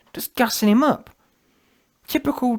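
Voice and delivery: ordinary delivery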